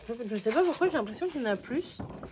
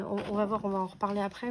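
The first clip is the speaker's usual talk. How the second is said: normal talking voice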